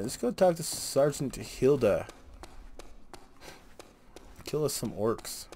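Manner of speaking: ordinary delivery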